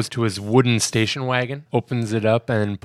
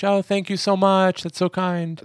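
high, bright call to a neighbor; mock mom calling out; 8 semitones higher than usual. Mom calls back to the coach across the yard, high and grateful. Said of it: "yells back to the coach".

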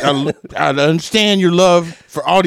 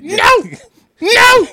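louder, higher "No!" twice, shaking headphones; repeated scolding shout; 5 dB louder than usual. Retold or imitated. Wife waving headphones at the dog, shouting no. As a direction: scold the clueless dog at full volume. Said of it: "She's like".

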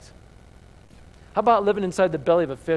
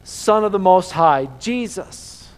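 loud ringing proclamation; the angel's title, proclaimed loudly; proclaiming; 2 semitones higher, 9 dB louder, slower than usual. Acted. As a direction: call it out like a herald. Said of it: "What an incredible proclamation".

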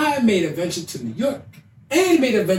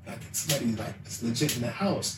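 much softer and lower, hushed; hushed warning; 10 semitones lower, 13 dB quieter than usual. Retold or imitated. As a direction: warn her under your breath. Whispering suspicion during sex.

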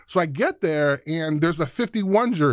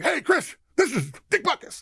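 much higher, changed voice for the legend on the phone; Dick Butkus impression; 10 semitones higher than usual. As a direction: play the famous linebacker calling a recruit.

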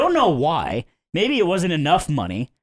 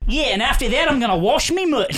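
a light, laughing imitation of an Australian accent; Sky's Australian accent; 8 semitones higher than usual. Joking to friends about teammate Sky, light mock Australian accent. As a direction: tease her with a hammed-up Australian accent, a little higher. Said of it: "I'll try to do her accent".

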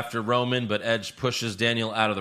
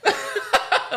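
airy laugh. Real, not acted. Mocking the commentary.

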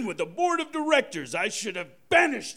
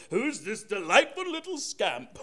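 ordinary talk, nothing marked

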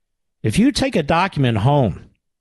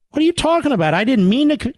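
much higher, panicked taxpayer voice; flustered citizen; 11 semitones higher, faster than usual. Acted. Accused taxpayer pleads with the agent, high and flustered. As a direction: protest like a caught innocent man.

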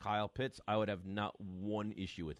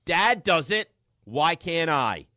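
much louder, higher defiant yell; kid talking back; 6 semitones higher, 13 dB louder, much slower than usual. Retold or imitated. Son yelling back at mom, blaming his dad, loud and defiant. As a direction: shout it back in protest. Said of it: "his response".

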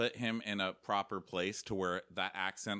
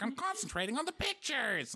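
high, earnest mock analyst; 13 semitones higher than usual. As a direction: parody someone concentrating hard. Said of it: "She's like".

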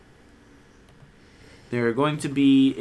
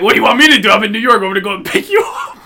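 loud, fast, annoyed reply; exasperated shout; 5 semitones higher, 8 dB louder, much faster than usual. Retold or imitated. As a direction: yell it back impatiently. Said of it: "And I'm like".

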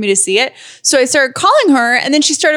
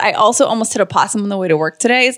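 ordinary talk

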